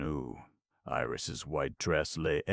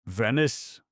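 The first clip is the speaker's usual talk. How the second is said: usual speaking voice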